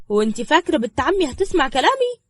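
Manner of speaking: high, loud incredulous question; challenging girl. Acted. Girl answering the woman who orders her out, defiant. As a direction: throw it back as a challenge.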